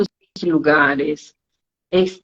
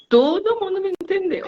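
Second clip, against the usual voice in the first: "Todo mundo me entendeu" much higher and softer, mocking; mock proud student; 11 semitones higher, 3 dB quieter than usual. Teacher mimics student's boast about being understood, very high and soft. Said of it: "e eles falam assim".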